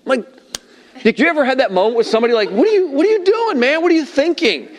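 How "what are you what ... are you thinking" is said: high-pitched incredulous scolding; mock outrage, pitched way up; exasperated outburst. Confronting somebody in that moment, exasperated mock outburst.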